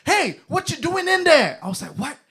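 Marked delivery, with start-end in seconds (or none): 0.1-1.6 high, loud, slow yell; angry producer shouting across the office; shouting man. Retold or imitated. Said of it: "this person shouts at me". 2.0-2.2 angry producer shouting across the office; shouting man. Retold or imitated. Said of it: "this person shouts at me".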